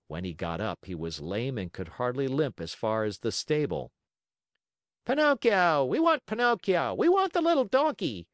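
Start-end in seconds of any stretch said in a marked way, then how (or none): 5.0-8.3 put-on very high, loud children's chant; shrieky high crowd call; boys crying out. A narrator's reading. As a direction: clamour like boys in the audience. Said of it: "cried the boys from the orchestra".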